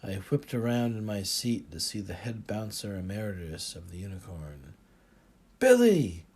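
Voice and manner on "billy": high, loud cry of delighted surprise; exclaimed. A narrator's reading. Narrator spotting the old bouncer, shouting his name in delight. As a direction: call out an old friend's name, overjoyed. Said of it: "I exclaimed".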